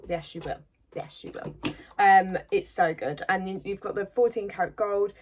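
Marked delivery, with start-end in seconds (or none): 0.9-1.5 a hushed, knowing whisper. Acted. To shoppers grabbing two, a sly whisper. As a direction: repeat it in a soft whisper.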